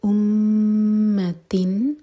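امتن recited very slowly, the meem long and held and the noon said clearly; recited Quran. Shown as a demonstration. Teacher demonstrating the recitation to the student, slowly. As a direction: draw out امتن in slow recitation. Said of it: "تن پر اظہار".

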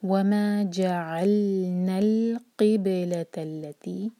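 very slow melodic chant; Quran recitation. Shown as a demonstration. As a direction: recite it drawn out, smooth on the लाम. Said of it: "किसी भी लाम साकिन पर झटका ना आए".

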